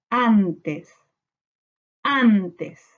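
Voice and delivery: "antes" in two measured syllables, an-tes, falling. Shown as a demonstration. Teacher separating syllables for pupils, "antes" as an-tes. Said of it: "separamos por sílaba".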